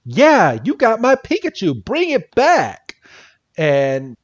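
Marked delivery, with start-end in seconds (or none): 0.0-2.9 put-on high, pleading boy's demand; a hint of whiny Ash calling out; lightly voiced kid hero shouting after the aliens; imitating Ash. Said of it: "And Ash is like".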